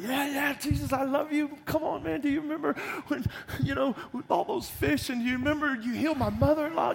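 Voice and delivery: a nervous, overeager Peter pleading; eager Peter. Acted. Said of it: "And Peter's like".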